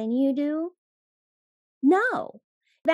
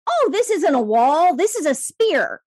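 louder, higher certain blind man; excited blind man; 6 semitones higher, 7 dB louder than usual. Retold or imitated. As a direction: announce your discovery, sure of it. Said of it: "and he's like".

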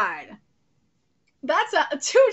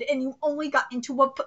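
ordinary talk

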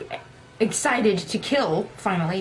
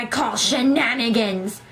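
slow, emphatic hammed-up protest; mock yell at the TV; slower than usual. Gamer protesting at the TV in a hammed-up slow yell. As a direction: drag out shenanigans in mock outrage. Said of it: "screaming at the T V".

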